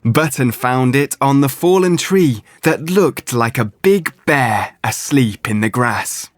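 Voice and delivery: plain reading voice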